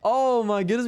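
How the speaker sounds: high, rising shocked cry; surprised. Real, not acted. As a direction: gasp it out in disbelief. Players eliminated en masse.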